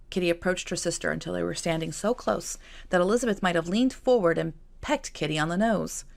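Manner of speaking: ordinary delivery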